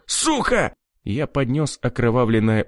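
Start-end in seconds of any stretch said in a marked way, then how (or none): 0.1-0.7 pained cry. A narrator's reading. Ear just cut off.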